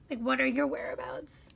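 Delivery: whispered example question. Shown as a demonstration. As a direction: ask it in a breathy hush.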